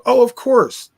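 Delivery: imitation of someone blithely sure; breezy self-assurance. Acts out smart people answering.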